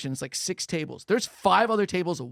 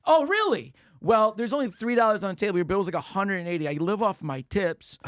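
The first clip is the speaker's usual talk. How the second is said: higher, louder sarcastic complaint; mock outrage; 5 semitones higher, 4 dB louder than usual. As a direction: call them out, fake surprise first. Confronting the ladies over tip.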